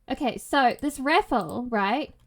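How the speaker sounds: ordinary delivery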